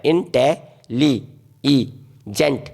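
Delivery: "इन, टे, ली, ई, जेंट" slowly, with gaps between pieces; slow teacher's sounding-out of "इन, टे, ली, ई, जेंट"; syllable by syllable. Shown as a demonstration. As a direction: break the word into "इन, टे, ली, ई, जेंट". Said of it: "वहाँ पर टूट जाता है".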